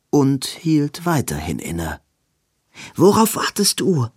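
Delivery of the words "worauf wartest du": impatient urging. Acted. Robin urges hesitating Edwin.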